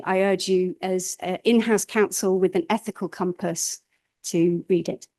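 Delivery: usual speaking voice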